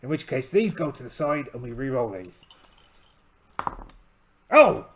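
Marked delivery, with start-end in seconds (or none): 4.5-4.9 sudden high loud gasp; surprised. Real, not acted. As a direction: caught off guard by the dice. Dice roll surprise.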